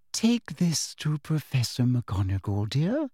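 slow, falsely sweet, rising dear; sugary teacher voice. A narrator's reading. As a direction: hand it over with sickly sweetness. Umbridge hands Harry the note.

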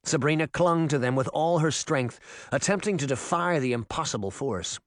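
ordinary delivery

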